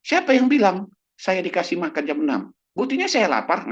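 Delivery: high, loud mock indignation; indignant protest. Old patient disputes the doctor about dinner, high indignant protest. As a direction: protest like an offended hungry patient.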